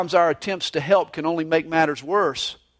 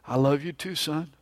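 a mild mock mutter from behind the paper; distracted dad, mumbled and softer; 4 semitones lower, 7 dB quieter than usual. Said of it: "the father muttered".

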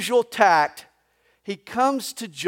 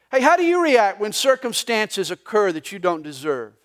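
ordinary talk

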